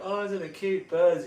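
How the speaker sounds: high, loud mock cooing; mocking high-pitched wonder at a bird; naive bird admirer. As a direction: put on a gushing onlooker's voice. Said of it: "You're like".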